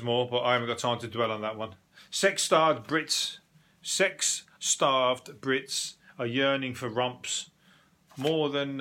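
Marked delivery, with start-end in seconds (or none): 2.1-8.8 dragged-out tabloid headline; slow headline read. Shown as a demonstration. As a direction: read it slowly for effect. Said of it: "reading a headline".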